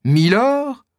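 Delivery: « Milord? » lancé très aigu, stupeur qui éclate; répéter « Milord? » bien plus haut, abasourdi; stupéfait. Acted. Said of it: "s'écrie D'Artagnan à son tour". (D'Artagnan répète le titre, incrédule, voix très aiguë)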